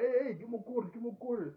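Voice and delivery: high, loud rowdy goon calling out; rowdy thug demand. Retold or imitated.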